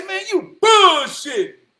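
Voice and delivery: high, loud, incredulous retort; acted-out outburst of disbelief. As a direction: call him out in a high voice, stretching the key word. Retelling own disbelieving reaction.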